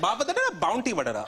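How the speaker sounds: mock gibberish Sinhala with an English word; coded mock language. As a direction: mimic mum's coded chatter. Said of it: "she'll try and code it up".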